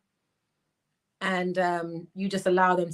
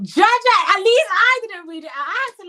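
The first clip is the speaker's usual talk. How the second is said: high, loud protest; amused outcry; 12 semitones higher, 8 dB louder than usual. Real, not acted. Host defends not reading the comment out, high-pitched protest.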